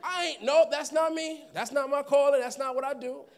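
high, loud laughing denial; loud proud refusal. Retold or imitated. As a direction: refuse it boldly, half laughing. Imitating his former proud self.

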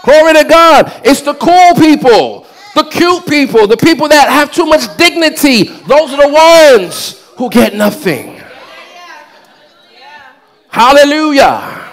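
rising, driving sermon voice; full-force preaching. Real, not acted. Preacher promising the congregation what worshipers get, full-force preaching.

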